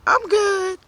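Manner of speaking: high, loud bright fib; fake cheerful. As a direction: chirp it to sound fine. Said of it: "I played the strong card".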